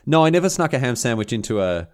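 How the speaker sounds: usual speaking voice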